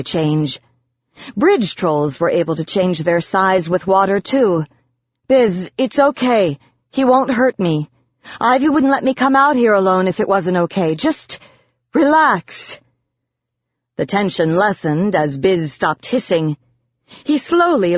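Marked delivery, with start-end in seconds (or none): 5.3-12.8 high-pitched, anxious soothing; urgent reassurance. A narrator's reading. Narrator to hissing Biz, urgent high reassurance. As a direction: hurriedly talk him down.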